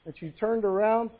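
normal talking voice

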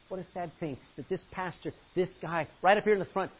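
usual speaking voice

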